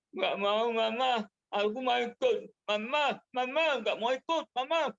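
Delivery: put-on child begging mama through tears; whining and crying child. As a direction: wail like a big kid refusing no. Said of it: "Woli merengek sambil nangis-nangis".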